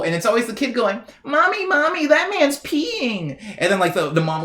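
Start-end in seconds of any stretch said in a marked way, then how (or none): 1.2-3.4 put-on very high, drawn-out little kid voice; imitating a small child. Mocking a kid pointing out a peeing man, squeaky child voice. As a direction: squeak it out like a tattling toddler.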